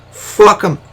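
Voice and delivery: a real crack, the pitch breaking up and loud. Real, not acted. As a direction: voice cracks up high on "Fuck 'em". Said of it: "That was a voice crack".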